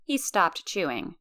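ordinary delivery

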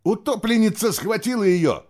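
high, loud cry of horror; horrified exclamation. A narrator's reading. Nicholas seeing the girl seized, horrified. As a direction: raise the alarm in horror. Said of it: "в ужасе воскликнул Николас".

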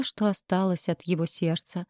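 usual speaking voice

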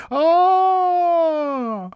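very high drawn-out wail; long theatrical howl; operatic wail. A narrator's reading. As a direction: let out a stage tragedian's howl. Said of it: "с шаляпинскими интонациями".

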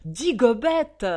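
loud, drawn-out exclamation; boisterous cry; exclaiming. A narrator's reading. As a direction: call out the order, high and slow. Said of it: "exclama Chopin".

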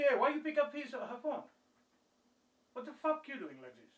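loud, high-pitched indignant demand; irate phone caller, re-enacted with force; angry retold scolding. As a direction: imitate someone angrily demanding an explanation. Acts out boss's angry phone call.

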